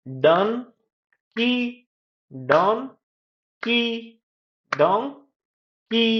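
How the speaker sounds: डं rising, long pause, high की; split into syllables. Shown as a demonstration. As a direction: break Dunki into two sung-out beats. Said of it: "दो syllable भी होते हैं".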